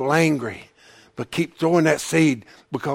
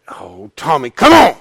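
exasperated self-prod, jumping to a shout; self-scolding; 10 dB louder than usual. Real, not acted. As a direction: chide yourself, bursting out on the last words. Scolds himself after stumbling over words.

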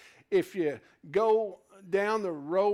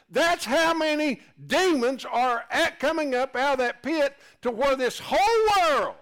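louder, higher fervent preaching; louder and higher, full-force sermon; 10 semitones higher, 7 dB louder than usual. Acted. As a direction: drive the point home to the congregation. Preacher surges again.

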